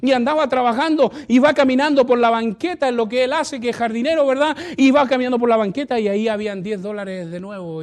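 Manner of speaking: loud, high preached storytelling; preaching at full force. Acted. Pastor retelling a testimony to the church, loud.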